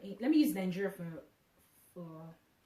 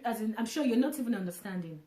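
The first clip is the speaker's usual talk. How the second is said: ordinary delivery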